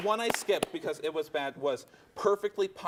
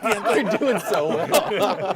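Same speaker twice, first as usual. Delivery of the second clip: laughing; 4 semitones higher, 5 dB louder, much slower than usual. Real, not acted. Laughing at the players' guesses.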